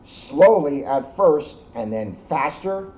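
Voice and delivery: gentle read-aloud storytelling; story narration. A narrator's reading. Reading the moon story to the children, storyteller voice. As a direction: tell it like a bedtime story to kids. Reads picture book to children.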